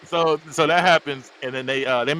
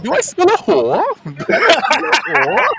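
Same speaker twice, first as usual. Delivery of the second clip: very high, loud cackling delivery; laughing quote; 7 dB louder, slower than usual. Retold or imitated. Quoting a movie line to friends, cracking up. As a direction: squeal the question through big laughs.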